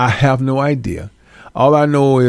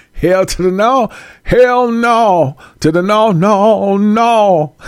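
1 emphatic refusal; 7 semitones higher, much slower than usual. Real, not acted.

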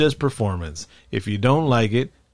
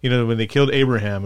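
usual speaking voice

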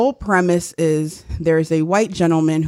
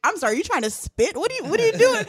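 mock bewilderment; 11 semitones higher than usual. Acts out a puzzled listener's mockery.

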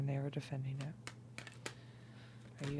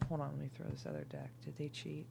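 ordinary delivery

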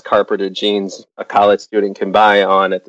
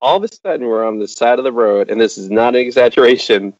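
ordinary talk

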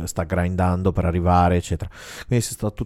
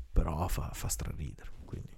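whispering; 3 semitones lower, 14 dB quieter than usual. Acted. Drops to a whisper.

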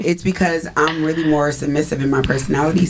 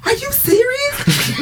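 high, slow, laughing disbelief; incredulous; slower than usual. Retold or imitated. Friend retelling her own bedroom reaction, laughing high disbelief. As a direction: stretch it out in laughing shock. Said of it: "I'm like".

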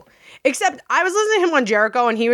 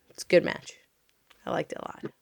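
bashful whisper; 6 semitones lower, 10 dB quieter than usual. Real, not acted. Gushing over favorite wrestler.

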